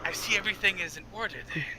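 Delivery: high cackle, then quick creepy words; gleeful hag cackle; cackling hag. Acted. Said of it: "she kinda shambles to the porch and goes".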